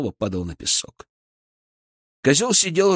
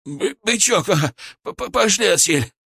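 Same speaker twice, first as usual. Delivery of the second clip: high, frightened stuttering plea; stammering cry; 9 semitones higher than usual. A narrator's reading. Козёл urges Бычок to flee, stammering cry. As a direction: stumble over the first sounds, pitched high. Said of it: "крикнул козёл".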